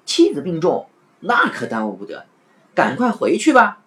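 put-on alarm, high and loud at the start; fake urgency. Waiter hurrying Afanti out, with faked urgency. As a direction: pretend to be in a hurry for him. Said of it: "店小二故作急切".

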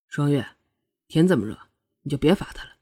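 low, soft, careful plea; tentative. A narrator's reading. Prince asking Shuangyue to end the punishment, tentative. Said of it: "终于试探性地说道".

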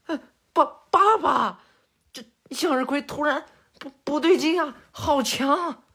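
high, loud panicked stammer; alarmed boy. Acted. Son calling his father as sister turns scary, panicked. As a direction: cry out to dad in alarm.

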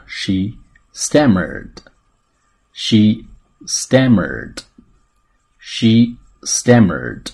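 very slow, separated words for repeating; stretched-out repeat-after-me demo; slow model reading. Shown as a demonstration. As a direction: say it loud and drawn out for learners. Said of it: "来做跟读练习".

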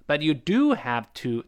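emphatic "do" before "have to"; stress on do. Shown as a demonstration. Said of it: "比后面的动词读得更重".

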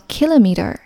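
kilometer as KIL-o-meter; British kilometer, first syllable stressed; stress before K. Shown as a demonstration. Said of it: "英式发音重音在K前面读作".

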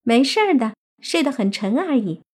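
put-on high, girlish, carefree voice; reassuring fox-demon girl. Fox girl says the roommates are fine, high and carefree. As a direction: brush off his worry as a little fox spirit.

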